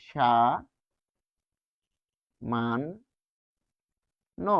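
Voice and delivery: "সা, মান, ন" as isolated syllables, sha, man, no; long pauses between "সা", "মান" and "ন"; demonstrated pronunciation. Shown as a demonstration. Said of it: "উচ্চারণ হবে তালব্য স দিয়ে". ("সা, মান, ন" আলাদা করে, শা-মান-নো)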